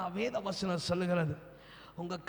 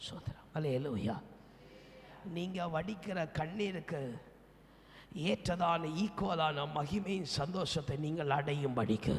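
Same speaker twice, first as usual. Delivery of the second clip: ordinary talk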